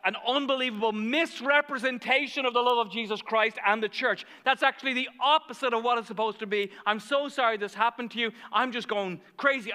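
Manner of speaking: high, loud, rushing sympathy; fired up. Retold or imitated. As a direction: re-enact an impassioned, indignant apology. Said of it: "I'm getting all fired up".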